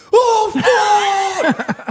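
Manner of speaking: high, loud startled shout; startled yell. Retold or imitated. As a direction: yelp it out in a fright. Goth kid startled, yelling back.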